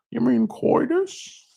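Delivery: high, slow put-on voice; Big Lebowski impression. Puzzled Lebowski character asks about coitus, high put-on voice. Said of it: "it's like Big Lebowski".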